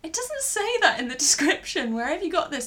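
playing her baffled self questioning Sam's fat bit; confused friend. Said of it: "And I'm just going".

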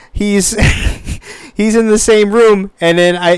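normal talking voice

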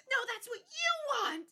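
high, loud mock outburst at her mom; Sophie's outburst. Friend imitating Sophie's meltdown at her mom, high mock outburst. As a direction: squeal it like a daughter in meltdown. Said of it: "she's like".

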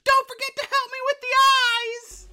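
very high, loud mock child's call; pestering kid. Son calling back to his busy dad, shrill kid imitation. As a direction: squeal it out like a nagging kid. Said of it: "the kid keeps coming back".

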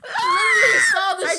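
very high, loud shriek; real disgusted shriek. Real, not acted. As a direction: scream it in disgusted laughter. Shrieking over snot.

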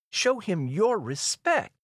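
high, slow imperious order; regal, drawn-out command; princess commanding. Acted. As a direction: demand it word by word. Princess giving guards an order.

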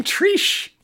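"Trish" with a long ee, like "Treesh"; odd pronunciation of Trish. Shown as a demonstration. Host noticing his own slip, "Trish" said as "Treesh". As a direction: stretch the vowel in "Trish" to ee. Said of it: "I keep saying Trish".

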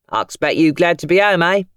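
lightly voiced chatty cabbie; a hint of a friendly London driver. Cabbie chatting to Tom, lightly voiced. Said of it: "the cabbie".

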